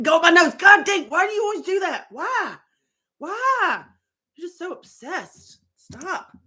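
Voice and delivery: fond exasperation at a nosy pet; high pet-scolding voice. Real, not acted.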